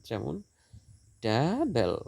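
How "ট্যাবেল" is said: "ট্যাবেল" with ট্যা long and rising; stress on first syllable. Shown as a demonstration. Teacher showing stressed syllables, stress on the start of "ট্যাবেল". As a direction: lean on ট্যা in "ট্যাবেল", let বেল fall. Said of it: "first syllable stressed".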